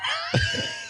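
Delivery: very high-pitched wheezing laugh; real squealing giggle fit; helpless laughter. Real, not acted. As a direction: crack up at your own bad accent. Cracks up at own failed accent.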